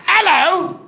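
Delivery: high, loud sing-song British "Hello."; hammed-up British greeting; mock British hello. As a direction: chirp "Hello." like a cheery Londoner. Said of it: "Everybody sounds like that over there".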